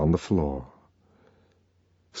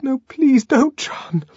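high frightened begging; cowering, high-voiced appeal; terrified pleading; slower than usual. Cowering from a threatened blow.

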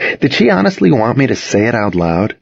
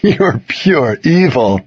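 slow, higher, mildly amused; spluttering laughter; 5 semitones higher, slower than usual. A narrator's reading. Boyfriend to teasing girlfriend, mildly spluttering. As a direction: draw it out, holding back a laugh. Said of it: "he spluttered, trying to get it under control".

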